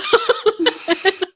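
squealing giggle up high; high burst of laughter. Real, not acted. Laughing after imitating the Southern teacher.

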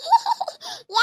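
short giggle. Acted. Laughing at a friend called auntie, giggle. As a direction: let out an amused little laugh.

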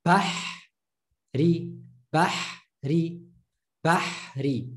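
"Bahri" with a breathy throat h after ba; Arabic word drill. Shown as a demonstration. Teacher models the word for students, throaty h. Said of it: "I went from the ba to the h".

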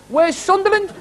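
high-pitched imitation of a drunk's heckle; mock drunken question, pitched right up; drunk heckler. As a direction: call it out high like a puzzled drunk. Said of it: "a drunk over there said".

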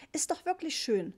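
put-on high-pitched older lady admiring; older woman. A narrator's reading. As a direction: pitch it up, pleased with your purchase. Older tourist admires her purchase. (hohe Stimme einer älteren Frau)

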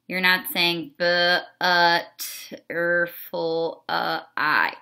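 "b, u, t, er, ful" broken into separate sounds; sounding out. Shown as a demonstration. Teacher showing the wrong way, sounding out each letter. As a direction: chop butterfly into single sounds. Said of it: "not sounding out the word".

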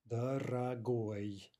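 "дорогой" very slowly, syllable by syllable; slowed-down model. Shown as a demonstration. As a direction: stretch out "дорогой" for learners, ending stressed. Teacher repeats word slowly, by syllable.

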